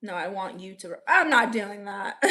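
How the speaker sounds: usual speaking voice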